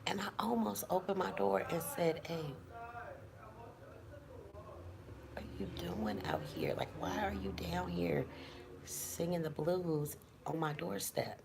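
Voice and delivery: real whisper so the neighbor won't hear; hushed whisper behind the door. Real, not acted. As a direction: whisper it, keeping hidden. Neighbor outside, avoiding being heard.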